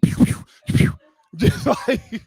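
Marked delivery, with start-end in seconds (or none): 0.0-0.9 unvoiced pew pew gunfire sounds; gunshot noises. Barging into the porn room firing pretend guns like Yosemite Sam. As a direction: fire off pretend gun sounds. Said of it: "like a horny Yosemite Sam".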